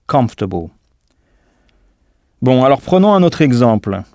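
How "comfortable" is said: "Comfortable" hit on COM, the rest falling away low; first-syllable stress. Shown as a demonstration. Teacher showing which syllable to strike, stress on COM in "Comfortable". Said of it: "c'est la première syllabe qu'il faut frapper".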